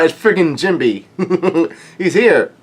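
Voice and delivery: high, laughing excitement; real giddy delight breaking through; thrilled. Real, not acted. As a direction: fan overjoyed at a favourite's arrival. Reader thrilled at character's arrival.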